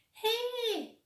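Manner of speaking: high call of a small creature; a bright high shout across the street. A narrator's reading. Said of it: "ar son go".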